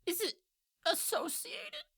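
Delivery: high pitched imp voice; suspicious imp. Acted. Imp questioning the disguised party in a high squeak. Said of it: "it looks over at Sereneth and goes".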